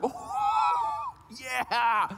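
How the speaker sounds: very high squealed "oh", then yeah; excited little boy. Retold or imitated. Son asked if Daddy can skip it, thrilled squeal. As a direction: imitate a child's thrilled shriek. Imitating son's excited reply.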